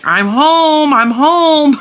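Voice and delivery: high, loud call to the dogs. Retold or imitated. Owner calls to the yelping dogs as she gets in, loud high greeting. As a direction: sing out I'm home over the barking. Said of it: "And I'm like".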